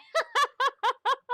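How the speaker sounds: short, high, loud cackling burst; loud cackle. Real, not acted. Cracks up at her own joke.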